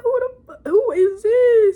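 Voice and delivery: exaggerated imitation of outraged disbelief; incredulous demand. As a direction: blurt it high, then stretch it out loudly. Imitating startled reaction to hello.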